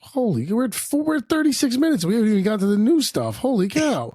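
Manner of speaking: high, astonished exclamation; amazed. Real, not acted. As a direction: marvel at how long it runs.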